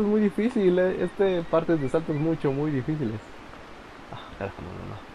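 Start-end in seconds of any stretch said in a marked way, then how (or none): 4.1-5.1 low, soft muttered curse; cursing under breath. Real, not acted. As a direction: grumble the curse to yourself. Failed jump, muttered curse.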